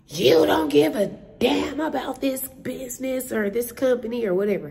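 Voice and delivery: mock angry tenant. Tenant ranting at concierge about the business, mock angry. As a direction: imitate the furious caller, hammed up. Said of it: "belligerent and rude".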